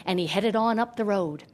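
usual speaking voice

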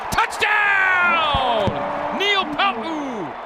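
huge high shouted call; touchdown shout. Real, not acted. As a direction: scream it like the crowd erupted. Announcer calling a touchdown.

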